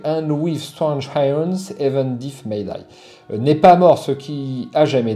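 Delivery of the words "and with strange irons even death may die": English motto read with a thick French accent. Shown as a demonstration. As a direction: recite the Lovecraft line in heavily French-accented English. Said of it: "avec mon accent, euh, légendaire".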